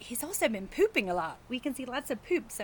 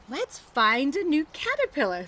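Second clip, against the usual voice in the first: louder, bright eager call; eager Pete; 5 semitones higher, 8 dB louder than usual. Acted. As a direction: cheer for the next caterpillar. Pete's eager line in book.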